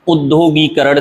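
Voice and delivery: उद्योगीकरण starting on short u, with long gee; correct pronunciation. Shown as a demonstration. Teacher correcting it, उद्योगीकरण said with initial u. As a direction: open उद्योगीकरण with ud-dyo. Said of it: "सही है".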